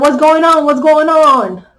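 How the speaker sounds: high, loud puzzled asking; a monkey baffled, pressing his friend; loud confused repeat. Acted. Monkey voiced in retold story.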